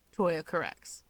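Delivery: ordinary delivery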